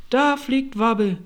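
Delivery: high, loud excited cry; crowd exclamation. Acted. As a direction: call it out as the astonished crowd. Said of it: "hörte sie einen allgemeinen Chor".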